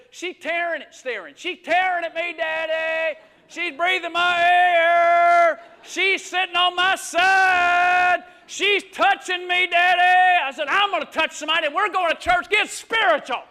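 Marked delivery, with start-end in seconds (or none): 1.4-10.4 put-on loud slow whining little girl; hammed-up whiny daughter tattling; whiny child. Daughter whining from back seat. 10.7-13.4 loud, quick fatherly warning; mock angry dad; stern dad threat. Dad threatening fussing kids.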